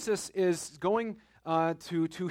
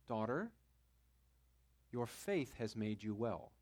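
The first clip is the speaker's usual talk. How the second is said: tender; 6 semitones lower, 8 dB quieter than usual. Acted. Jesus speaking to healed woman.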